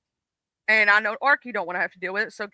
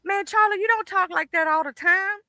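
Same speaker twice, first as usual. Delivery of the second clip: higher-pitched mimicked challenge; raised pitch, mock disbelief; imitating doubting people; 10 semitones higher than usual. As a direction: lightly mimic folks calling you out. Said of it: "People like".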